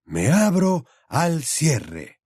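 slow, high, wondering reading of the riddle; puzzled slow recitation; trying a new intonation. A narrator's reading. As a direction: sound the words out as if testing them. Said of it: "dándoles diferentes entonaciones".

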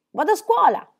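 shouted. A narrator's reading. Peter calling out as he leaves home, loud shout. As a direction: fling it out as he runs off. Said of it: "e gridò".

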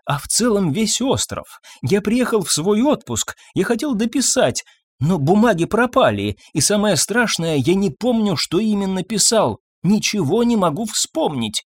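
high, anxious lament; distressed doctor. A narrator's reading. Doctor tells of lost papers he cannot remember, distressed.

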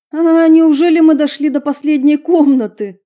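high, loud, amazed exclaim; excited. Acted. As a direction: throw up your hands in excitement. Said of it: "всплеснула руками Стелла".

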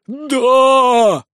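high, drawn-out roar; long shout of elated assent; ecstatic crowd yell. A narrator's reading. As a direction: cheer yes in a frenzy. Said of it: "Толпа явно была в экстазе".

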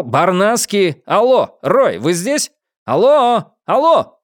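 calling out. Acted. Marcus calling for Roy on a phone conference, calling out. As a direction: call out to check he's there.

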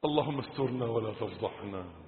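soft, paused reproach; quiet admonition. Real, not acted. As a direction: speak low-key, ending in a soft, low prayer. Drops to quiet reproach and prayer. (موعظة هادئة تنتهي بدعاء خافت)

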